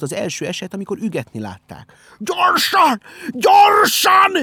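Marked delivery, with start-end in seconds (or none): 2.2-4.3 full-throated panicked yell, pitched very high; frantic shout. A narrator's reading. Benyámin raising the alarm to the animals, frantic shout. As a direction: bellow it urgently at the top of your lungs. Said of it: "üvöltötte".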